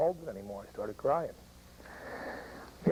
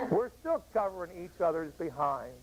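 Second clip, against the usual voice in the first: ordinary talk, nothing marked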